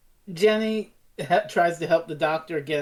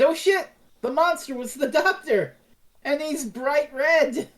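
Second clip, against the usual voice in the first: excited reveal; 10 semitones higher than usual. Real, not acted. Retelling the episode, building the twist with excitement.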